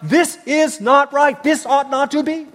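loud, high, slow protest; crying out. Retold or imitated. Habakkuk crying out against injustice, loud slow protest. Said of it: "he's crying out".

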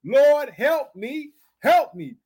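slow, high, insistent plea; crying out. Shown as a demonstration. Preacher modelling the plea for the congregation, crying out. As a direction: draw the plea out, then repeat it. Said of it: "That's what I'm saying today".

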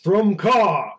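calling out. Acted. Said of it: "while walking up".

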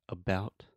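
"about" with a short, soft, reduced first a; schwa in about. Shown as a demonstration. Teacher modelling the reduced first vowel of "about". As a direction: swallow the first a of "about" into a quick uh. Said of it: "The first sound".

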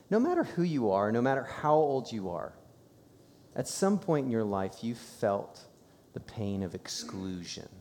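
normal talking voice

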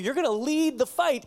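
ordinary talk

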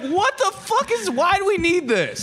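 baffled. Retold or imitated. Baffled by truck buttons.

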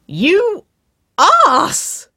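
very high, loud outraged squeal. A narrator's reading. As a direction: squeal it out, stretching each word. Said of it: "Waverley squealed".

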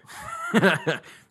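high, loud burst of laughter. Real, not acted. Friend laughs at nose blowing.

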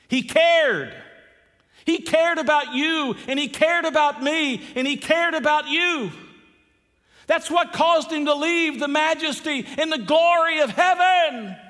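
high, ringing pulpit shout; fervent proclamation. Real, not acted. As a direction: proclaim it at full force. Preacher erupts after quiet setup.

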